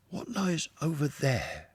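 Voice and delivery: a newcomer seeing the river for the first time; Mole, awed and a little breathless; wide-eyed Mole. A narrator's reading. Mole curious.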